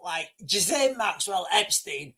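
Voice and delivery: ordinary talk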